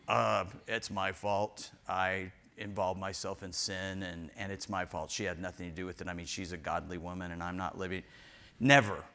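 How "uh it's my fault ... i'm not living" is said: mock husband confession. Pastor mimicking a repentant husband, low and hushed. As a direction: drop deep and quiet like a broken husband. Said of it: "Or the husband going".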